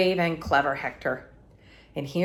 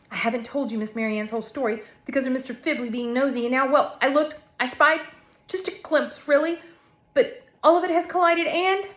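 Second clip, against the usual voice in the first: faster and higher, words tumbling; excited rush; 6 semitones higher than usual. Acted. Narrator blurting her discovery to Hector, excited rush. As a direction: pour it out breathlessly.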